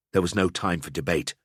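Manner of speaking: usual speaking voice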